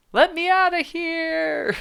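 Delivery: high, slow desperate cry from the cell; trapped prisoner crying out, high and drawn out; prisoner's plea. Acted.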